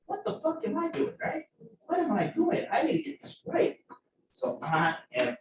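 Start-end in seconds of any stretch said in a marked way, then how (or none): 0.1-3.8 high-pitched retold self-scolding; livid at himself. Student scolding himself over his lab results, livid. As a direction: berate yourself, pitched well up. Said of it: "livid, right? I'm so mad".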